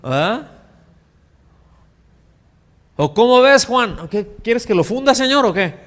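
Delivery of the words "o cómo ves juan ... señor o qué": sarcastic jeer. Retold or imitated. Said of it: "mirada sarcástica".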